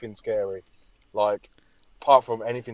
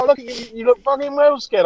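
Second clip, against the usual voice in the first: higher, louder teasing; mock him higher and louder like the mates; teasing mockery; 12 semitones higher, 7 dB louder than usual. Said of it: "Everyone's like".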